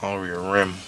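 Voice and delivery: talking around a pipe stem; pipe in mouth. Real, not acted. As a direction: speak with a pipe clenched in teeth. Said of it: "Try saying peas with a pipe in your mouth".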